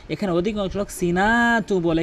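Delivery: ordinary delivery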